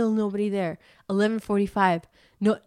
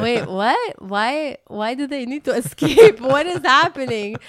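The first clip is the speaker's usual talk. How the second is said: louder and incredulous; alarmed; 4 semitones higher, 8 dB louder, slower than usual. Retold or imitated. Mom reacting to the locked gate, louder and alarmed. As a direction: sound baffled and alarmed. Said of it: "then I was like".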